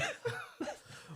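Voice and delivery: silent breathy laughter; wheezing laugh. Real, not acted. Unvoiced laugh.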